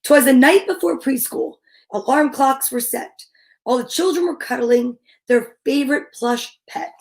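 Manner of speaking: measured, rhythmic picture-book narration; storybook read-aloud. A narrator's reading. Reader reading preschool story to little kids, measured rhythmic narration. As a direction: read the rhyme slowly to small children.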